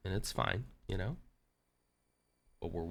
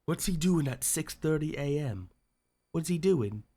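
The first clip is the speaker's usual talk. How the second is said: lightly voiced mock Tate, pitched up; 7 semitones higher than usual. Streamer voicing Tate watching his stream, a light mock Tate. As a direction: ask it like a scornful Tate, higher. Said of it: "I can't do a Tate".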